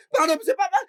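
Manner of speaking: half-laughing, half-sobbing pardons; crying apology. Acted. Employee pleading with the boss for pardon, crying. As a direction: beg through tears.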